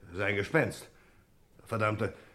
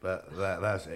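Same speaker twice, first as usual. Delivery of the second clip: quiet, lower stammer of disbelief; stammering shock; 6 semitones lower, 8 dB quieter, slower than usual. Acted. Husband hearing about his wife's handbag, quiet shocked stammer. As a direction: stumble over the word in shock.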